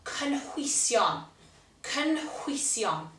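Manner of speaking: cynhwysion said slowly, syllable by syllable; teacher's slow Welsh; Welsh word demo. Shown as a demonstration. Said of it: "Ingredients is cynhwysion".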